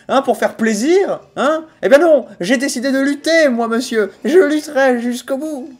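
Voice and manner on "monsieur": ordinary delivery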